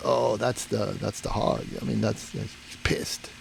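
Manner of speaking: low-volume, halting words sinking to a whisper; hushed warning. Retold or imitated. Guide telling hunter about the hog in the grass, hushed warning. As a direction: imitate a guide speaking under his breath.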